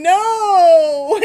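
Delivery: loud laughing mock protest; a high, laughing shout of no. Reader to client, imitating her refusal: loud laughing no. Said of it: "you're both going".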